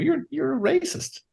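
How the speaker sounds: high, slow pointed accusation; a high, drawn-out accusing voice; mock accusation. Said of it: "you're kind of going like".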